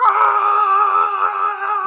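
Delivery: piercing, very loud held shriek; hammed-up endless yell; mock movie scream. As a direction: parody a scream dragged on far too long. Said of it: "Why are you screaming like this".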